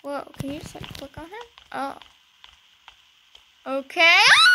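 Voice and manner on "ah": high, loud scream of fright; frightened scream. Real, not acted. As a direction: scream out at a jump scare. Jump scare.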